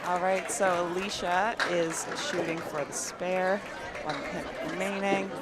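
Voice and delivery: bowling call, lively then hushed; live play-by-play. Acted. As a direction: call each ball as it rolls.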